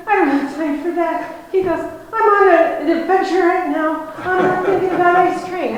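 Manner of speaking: high, loud imitation of a farmer off on an adventure; eager brush-off. Old farmer telling her he's on an adventure, high and loud. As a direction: wave the ice cream off, too excited to care.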